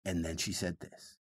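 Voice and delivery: very low, hushed delivery; all the way down. Shown as a demonstration. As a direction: drop to a low, very soft voice. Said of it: "take it all the way down to that".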